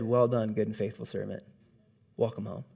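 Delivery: low, hushed and solemn; voice of God, retold. [name] retelling God's welcome, low and hushed. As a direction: quietly echo God's blessing. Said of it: "he said".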